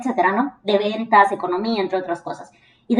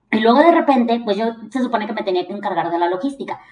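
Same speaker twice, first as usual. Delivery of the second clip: normal talking voice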